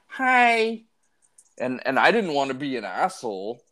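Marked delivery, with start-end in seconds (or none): 0.1-0.8 put-on high, tiny toddler voice; little girl's hi. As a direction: chirp it like a three-year-old girl. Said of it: "and she's like".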